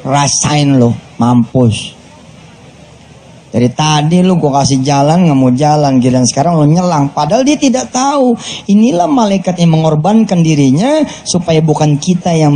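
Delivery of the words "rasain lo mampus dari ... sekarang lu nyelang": lower, smug gloating at the other driver; gloating driver muttering rasain lo; gloating mock. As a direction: sneer it like a petty driver cursing. Mocking the reckless driver.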